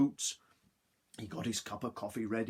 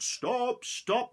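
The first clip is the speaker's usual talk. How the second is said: high, loud shout of stop; 14 semitones higher, 5 dB louder than usual. Acted. Husband chasing the gingerbread man, loud high shout. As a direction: yell after the runaway. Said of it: "shouting out".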